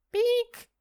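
high synthetic beep; electronic beep. Acted. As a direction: beep like a machine chime. Chip system beep.

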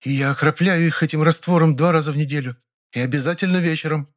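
high, stern proud instruction; strict boasting. Acted. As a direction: state the rule, proud. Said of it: "строго похвасталась".

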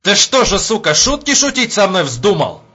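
loud, high angry threat; furious. Acted. Major accuses the sentry of playing jokes, furious. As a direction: round on him, enraged.

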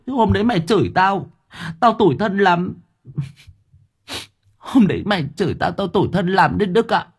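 high-pitched, aggrieved pleading in a mock-wronged voice; hammed-up lament. Host pleading with absent friend for pity, hammed-up lament. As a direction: wail out your grievance in a high, theatrical plaint.

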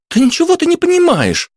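put-on high, indignant teen girl; giddy party girl. A narrator's reading. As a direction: protest like a squeaky girl. Teen argues back.

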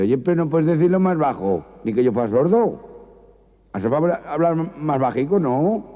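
riña alta y exasperada; estanquero harto. Acted. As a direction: exige que baje la voz. Estanquero harto pide hablar bajo. (estanquero harto del maño)

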